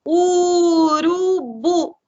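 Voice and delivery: "Urubu" very slow, split u-ru-bu, sounding out each vowel; "Urubu" sounded out slowly in three long beats; syllable by syllable. Shown as a demonstration. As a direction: stretch every syllable of "Urubu" as a teacher would. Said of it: "Vamos falar de novo?".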